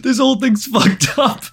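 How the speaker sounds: mock freakout. Acted freaked-out teen.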